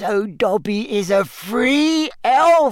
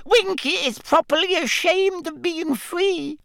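very high indignant elf; proud ashamed elf squeak; house elf voice; 10 semitones higher than usual. Acted. Winky elf voice.

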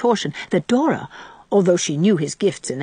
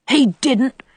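indignant; 6 semitones higher, 4 dB louder than usual. A narrator's reading. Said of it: "cried Davy indignantly".

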